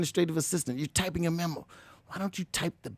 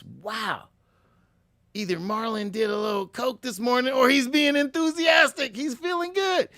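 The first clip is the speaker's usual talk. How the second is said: amazed boss; 6 semitones higher than usual. Retold or imitated. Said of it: "your boss is probably gonna notice".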